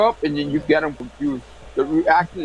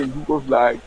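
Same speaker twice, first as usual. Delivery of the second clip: normal talking voice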